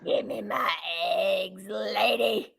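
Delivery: a put-on bunny growl that breaks into a mock cry on "lady"; mock menacing bunny. Seller voicing the angry bunny on the figurine, mock menace. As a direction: make the little bunny demand his eggs.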